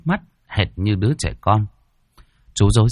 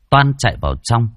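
ordinary delivery, nothing marked

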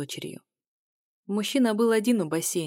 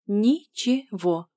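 "Ничего" very slow, broken into syllables; slow, emphatic syllable-by-syllable delivery; deliberate, spaced out; 2 semitones higher, 4 dB louder, much slower than usual. A narrator's reading. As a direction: say "Ни-че-го" with a pause after each syllable. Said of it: "произнесла с расстановкой".

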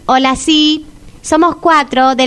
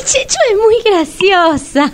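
usual speaking voice

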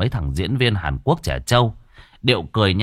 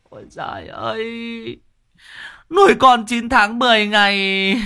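much higher, loud keening lament over a dead son; wailing mourner; 3 dB louder than usual. A narrator's reading. Grieving parent wailing over their dead son, high keening lament. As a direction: howl the grief like a mother at the coffin. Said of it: "vang lên những tiếng khóc thấu trời".

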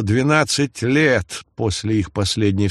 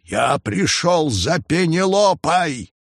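raised, slow call; shouting; 6 semitones higher, slower than usual. A narrator's reading. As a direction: call out demanding an answer. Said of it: "снова крикнул Нестор".

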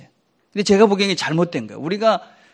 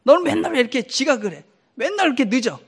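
mock scolding; 7 semitones higher than usual. Shown as a demonstration. Scolding example to avoid.

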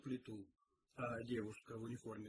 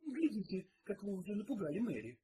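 mild scolding, pitched up; indignant; 6 semitones higher than usual. A narrator's reading. Cook blames detective for frightening the maid, indignant. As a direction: reproach him from a high start. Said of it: "возмутилась кухарка".

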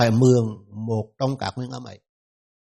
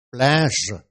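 English word pleasure. Shown as a demonstration. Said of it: "Trong tiếng Anh có một từ".

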